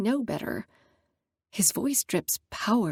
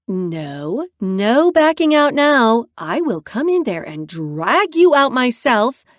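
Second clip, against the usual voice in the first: bully her out of the car playfully; threatening; 3 semitones higher, 6 dB louder, slower than usual. Acted. Friend threatening to drag Nora out, loud mock threat. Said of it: "she threatens".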